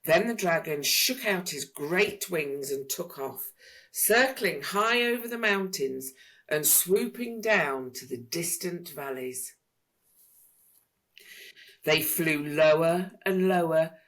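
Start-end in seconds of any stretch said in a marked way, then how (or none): none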